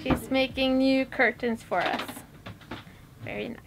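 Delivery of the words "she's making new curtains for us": ordinary talk, nothing marked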